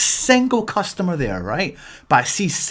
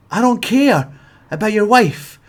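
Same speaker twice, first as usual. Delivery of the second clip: building rant; 5 semitones higher, 4 dB louder than usual. Real, not acted.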